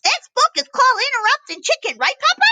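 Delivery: put-on high, eager child voice; little chicken voice. Chicken asking Papa about the book title in a high voice. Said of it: "and it says".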